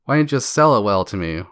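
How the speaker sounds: usual speaking voice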